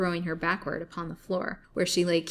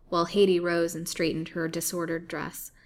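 ordinary delivery

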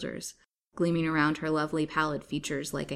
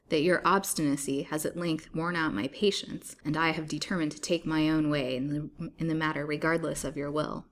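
ordinary delivery, nothing marked

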